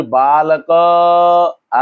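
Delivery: बालक with the last "a" sounded and held long; drawn-out final vowel. Shown as a demonstration. Teacher showing the end sound of बालक, drawn-out final vowel. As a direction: stretch out the closing vowel of बालक आ. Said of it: "आ रहा है ना बाद में".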